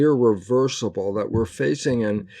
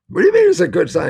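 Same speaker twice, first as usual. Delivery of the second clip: quick, much higher and louder disbelief; imitating a startled young listener; incredulous retort; 9 semitones higher, 7 dB louder, much faster than usual. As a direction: fire back like the puzzled youngster. Imagined listener objects, surprised.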